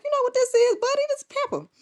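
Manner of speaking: put-on high, sing-song coo to a toddler; baby talk. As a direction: talk to the baby like a cooing mom. Cooing to baby about pamper.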